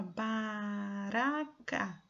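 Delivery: BARACA very slowly, with a tapped R; single-R sound. Shown as a demonstration. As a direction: stretch out baraca, syllables long, R light. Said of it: "é baraca com um R".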